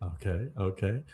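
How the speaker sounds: ordinary talk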